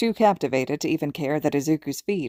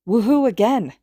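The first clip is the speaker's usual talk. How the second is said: louder higher whoop; excited whoop; cheering; 6 semitones higher, 6 dB louder than usual. A narrator's reading. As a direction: cheer him on. Cheering reply.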